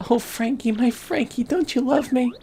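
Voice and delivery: high-pitched worried sweetheart; pleading girlfriend. Acted. Daughter begs boyfriend not to go back, high pleading voice.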